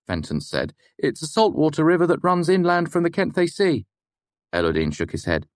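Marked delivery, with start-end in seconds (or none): 1.0-3.9 higher, eager student reciting; student reciting a fact to the lecturer. Acted. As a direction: offer the fact hopefully. Fenton continues.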